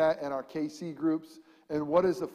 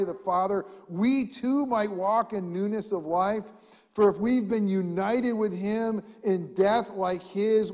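scripture reading; 4 semitones higher, 7 dB louder than usual. Acted. Pastor reading Romans to church, weighty and loud. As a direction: read the verse like a proclamation.

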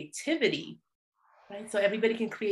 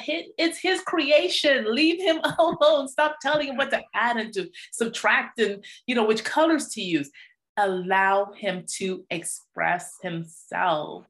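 indignant protest; 3 dB louder than usual. Retold or imitated. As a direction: stand up for the child, outraged. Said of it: "I thought".

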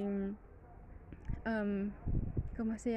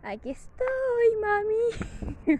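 high, slow, whiny little pet voice; voicing the dog answering its owner; dog's voice; 10 semitones higher, 5 dB louder, much slower than usual. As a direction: give the dog a tiny, pleading voice.

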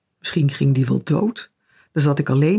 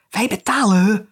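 higher, hissed stage whisper; hissing; 4 semitones higher than usual. A narrator's reading. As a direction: hiss it as a hushed aside.

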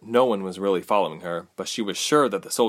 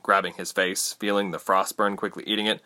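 plain reading voice

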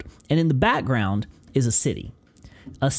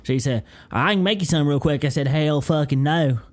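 hammed-up Southern storyteller, running on; Southern accent. Host telling of boiled peanuts, put-on Southern accent. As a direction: spin a country yarn in thick Southern. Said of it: "start leaning into the Southern accent".

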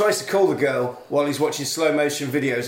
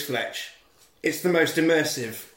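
ordinary delivery

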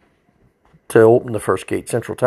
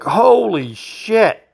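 high, very slow holy shit; stunned disgust; 9 semitones higher, much slower than usual. Retold or imitated. Trooper realizing the man in back soiled himself, drawn out in disgust. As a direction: let the realization sink in.

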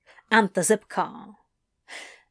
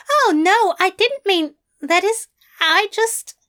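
high, flustered protest; flustered denial; 11 semitones higher, 5 dB louder than usual. Acted. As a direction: backpedal in a fluster.

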